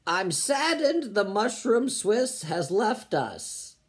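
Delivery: ordinary talk, nothing marked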